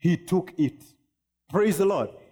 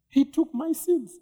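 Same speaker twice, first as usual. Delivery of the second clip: joyful; 10 semitones higher than usual. Retold or imitated. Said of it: "and she's like".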